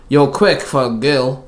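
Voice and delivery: slow, mildly barked jibe; barked taunt. A narrator's reading. Assassin taunts the fighting queen, mildly barking. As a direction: drag out the taunt with slight force. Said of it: "the assassin barked".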